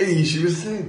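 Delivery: mock Yorkshire punchline; hammed-up Yorkshire exclamation; hilarious Yorkshire accent. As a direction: replay the joke in put-on Yorkshire. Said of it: "my hilarious Yorkshire accent".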